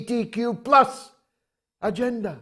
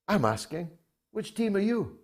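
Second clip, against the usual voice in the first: ordinary talk, nothing marked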